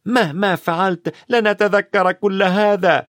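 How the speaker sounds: high-pitched burst of frustration; exasperated outburst. A narrator's reading. Ron gives up on revision, high exasperated outburst. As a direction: burst out, fed up with it all. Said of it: "انفجر رون قائلا".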